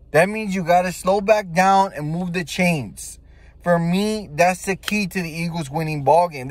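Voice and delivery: normal talking voice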